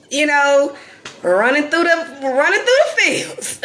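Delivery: a hint of playing the ignorant country girl; mock rural voice, laughing.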